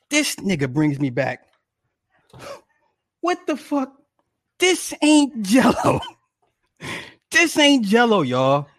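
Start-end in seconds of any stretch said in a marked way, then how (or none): 3.2-8.2 much higher, stunned outrage; can't believe what he's holding; outraged disbelief. Real, not acted. Husband staring at fake Jell-O, high disbelief.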